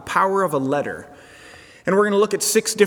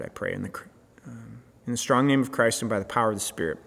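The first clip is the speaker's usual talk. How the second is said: prayer; 5 semitones lower than usual. Real, not acted. Pastor praying over the new sermon series, hushed prayer. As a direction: pray aloud gently, searching for the words.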